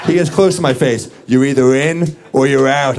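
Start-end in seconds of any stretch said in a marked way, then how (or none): 1.3-3.0 tough-guy threat. Retold or imitated. Jacked dude threatening.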